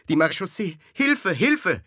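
high, loud call for rescue; screamed cry for help. A narrator's reading. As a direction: shriek for help in alarm. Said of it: "schrie die Martiniere". (schriller Hilfeschrei)

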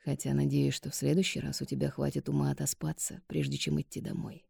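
softly dry fatherly rebuke; low-key, half-muttered; quiet. Acted.

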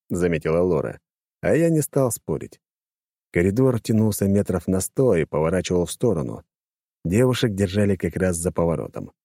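plain reading voice

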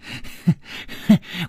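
breathy unhinged giggle; crazed giggle. A narrator's reading. Gong Nanyan mocking the stabbed Bear Lady, crazed giggle. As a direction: giggle like a madwoman. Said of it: "痴痴地说".

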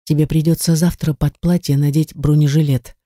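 ordinary delivery

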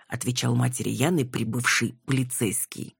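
ordinary delivery

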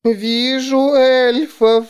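high, slow, ringing seer voice. A narrator's reading. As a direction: chant it like a ghostly seer. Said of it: "Звенящим голосом женщина заговорила".

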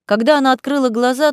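ordinary delivery, nothing marked

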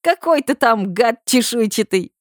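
high, slow giggling chant; hysterical laughing shout. A narrator's reading. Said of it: "выкрикивает сквозь ... истеричный ржач".

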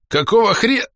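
high grumpy outburst, cut off mid-word; hoarse and displeased. A narrator's reading. Narrator voices a man jolted awake by a shove, hoarse and displeased. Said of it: "хрипло и недовольно задал невинный вопрос".

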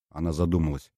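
plain reading voice

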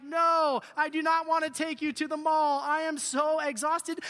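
lightly acted fed-up father; refusing dad. Acted exhausted dad refusing again.

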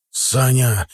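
mildly alarmed call; a touch of a father's fright; frightened call. A narrator's reading. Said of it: "испуганно крикнул Вовка". (испуганный оклик, сдержанно)

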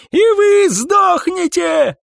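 high, loud, slow-drawn shriek; screaming. A narrator's reading. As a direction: scream it as a curse on them. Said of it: "кричал Сайрус". (истошный крик)

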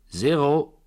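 high, loud croupier's call; croupier's announcement. A narrator's reading. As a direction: announce the result to the table. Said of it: "verkündete der Croupier". (laut verkündender Croupier)

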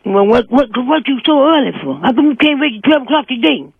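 ordinary talk

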